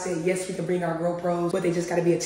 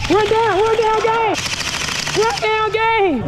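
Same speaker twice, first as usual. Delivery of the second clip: hype yelling; 9 dB louder, slower than usual. Pretend. Hype chant, loud and high.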